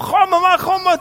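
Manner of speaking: high, loud call to a friend. Retold or imitated. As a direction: call your mate over. Calling friend over loudly.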